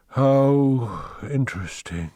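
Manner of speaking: slow, faint dying murmur; dying last words. Acted. Dying Bierce, falling to the ground, slowly murmurs his last words. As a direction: breathe it out slowly as life leaves. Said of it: "he had time to say only".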